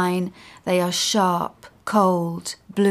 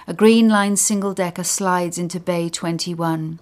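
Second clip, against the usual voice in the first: ordinary delivery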